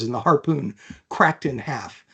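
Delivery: ordinary talk, nothing marked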